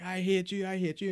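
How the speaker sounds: high-pitched imitated yell, not full force; half-shouted tantrum in retelling; Jon Jones mock screaming. Friend retelling the scene by the cop car, high mock scream. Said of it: "he's just screaming".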